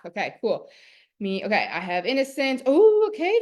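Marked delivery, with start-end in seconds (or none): 2.6-3.4 high, loud burst of real surprise; a sudden delighted oh; surprised. Real, not acted. Streamer sees a comment and reacts, surprised.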